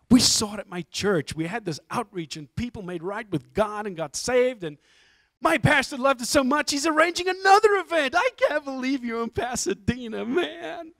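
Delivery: gushing dude, climbing to a high squeal; excited teller. Retold or imitated. Teller praising film to its maker, building excitement. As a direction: imitate a fan building into high-pitched awe. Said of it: "he's so excited".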